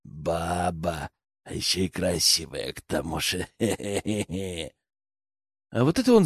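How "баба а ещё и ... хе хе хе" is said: low, quiet, repulsive leer with a chuckle; vile rasp. A narrator's reading. Poacher leering at a captive woman, nasty rasp. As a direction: rasp it lewdly, ending in a slow snicker. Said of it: "прохрипел он мерзким голосом".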